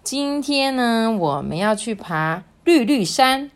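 put-on slow, lilting read-aloud for children; storybook narration. A narrator's reading. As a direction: draw young listeners into the story, unhurried.

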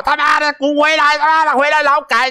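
high, mocking angry netizen; imitating furious Chinese netizens; mock netizen cursing. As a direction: spit it out like an online troll cursing. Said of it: "酸民们骂爆".

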